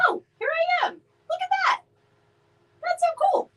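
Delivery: very high-pitched, squeaky delight; excited surprise. Real, not acted. Sees herself appear on stream.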